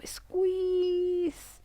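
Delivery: high, long-held squeeze; sustained high-pitched straining cue. Shown as a demonstration. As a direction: stretch the word out high, as if tensing hard. Coaching tense-and-release exercise.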